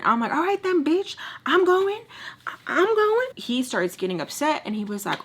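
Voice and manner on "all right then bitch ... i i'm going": much higher, louder retort; defiant yell. Retold or imitated. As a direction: snap back, ready to fight. Retold defiant reply.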